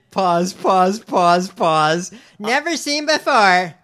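high, loud, over-the-top read; mock the press secretary's peppy delivery; loud parody of a cheerful briefing voice; hammed-up excited announcer. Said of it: "if this is your read on it".

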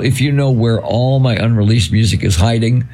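ordinary talk

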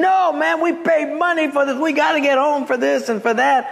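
high-pitched indignant crowd complaint; steaming mad. Retold or imitated. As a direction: act out a fuming stranded passenger. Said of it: "They are steaming".

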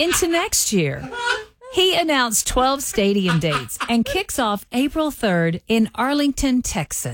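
slow, AI-like neutral American accent; accent-neutral read. Shown as a demonstration. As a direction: carefully enunciate without any Southern accent. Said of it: "This is what A I Dallas would sound like".

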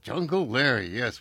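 usual speaking voice

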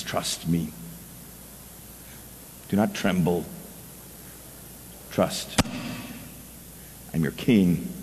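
soft reassuring voice of God. Acted. Preacher voicing God to his worried people, soft and reassuring. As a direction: hush down and comfort the listener.

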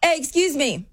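lightly voiced stranger calling excuse me; calling after her. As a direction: mimic a guy hailing her from a distance. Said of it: "I hear this man behind me go".

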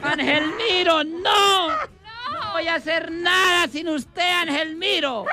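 high, crying wail at the coffin; hammed-up sobbing widow cries out for her dead. Widow at the funeral wailing over the coffin, hammed-up crying shouts. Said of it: "y ella lloraba en el atolón".

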